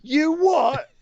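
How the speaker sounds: high, loud mock outrage; mock Pete Dunne. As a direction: hit it like a baffled brawler. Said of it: "came out and said".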